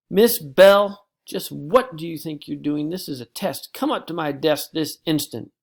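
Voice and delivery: loud, high, stern rebuke; teacher catching a pupil talking in a test; stern teacher scolding. Acted. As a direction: call her out sharply.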